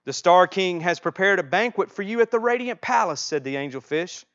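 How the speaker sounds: animated read-aloud, lifting the characters' lines; children's storybook reading. Acted. Reading a children's book to the congregation for comfort, animated storytelling. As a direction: read it with lively, rolling storyteller emphasis.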